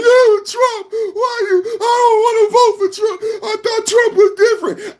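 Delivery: high, far-off fake sobbing whine; mock crying. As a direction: whimper it like a crybaby. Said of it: "I didn't start crying".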